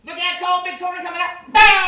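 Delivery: put-on high child voice, playful warning; a kid playing corners in the back seat; excited little boy. Plays himself as child in car.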